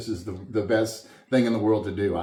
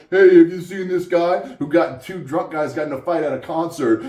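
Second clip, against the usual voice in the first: loud, higher mock calling out; mock door-to-door canvassing; 6 semitones higher, 6 dB louder than usual. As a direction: call out like a sarcastic canvasser. Imagined police canvassing door to door.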